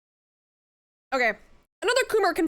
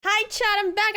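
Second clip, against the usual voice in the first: excited; 8 semitones higher, 5 dB louder than usual. Real, not acted. Returning to stream, bright greeting.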